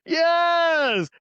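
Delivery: loud, high yell of delight; real excited yelling, high and loud; excited shout. Real, not acted. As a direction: shout yes with real glee.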